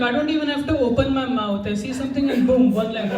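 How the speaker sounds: ordinary delivery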